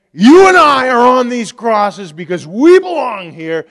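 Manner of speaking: a condemned man rebuking the mocker; rebuking thief. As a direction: scold the other thief with force.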